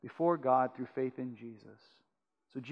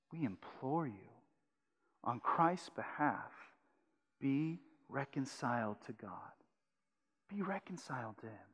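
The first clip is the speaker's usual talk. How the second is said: tender reading; 2 semitones lower, 9 dB quieter than usual. Shown as a demonstration. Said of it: "Paul says".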